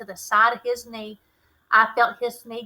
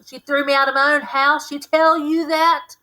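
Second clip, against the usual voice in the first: louder, bitter complaint; angry demand; 5 semitones higher, 8 dB louder than usual. A narrator's reading. Drunk father complains his wife threw him out, angry and louder. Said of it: "he demanded".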